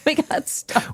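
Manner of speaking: breathless high giggle; giggling. Real, not acted. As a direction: laugh through the words. Giggling, stuck while stoned.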